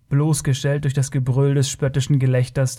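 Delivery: ordinary delivery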